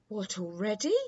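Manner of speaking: mildly startled prince; exclaimed. A narrator's reading. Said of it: "exclaimed the prince".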